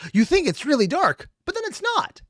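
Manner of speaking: high, loud sing-song mock of the video's joke. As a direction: parody the joke with an exaggerated rising voice. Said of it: "The entire internal joke of this video was".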